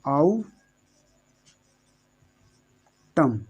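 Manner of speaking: आउटम split as "au", long pause, "tam"; syllable by syllable. Shown as a demonstration. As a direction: say आउटम very slowly in two separated halves. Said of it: "इसको पढ़ेंगे सिर्फ आउटम".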